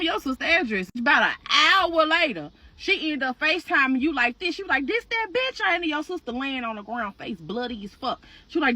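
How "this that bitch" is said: high, challenging "this that bitch"; tough question. Retold or imitated. Sister shows the beaten woman over FaceTime, challenging. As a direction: demand it with swagger.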